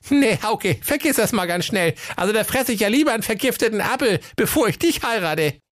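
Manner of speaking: high-pitched fairy-tale princess voice. Acted. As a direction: rebuff him in a high, brisk voice.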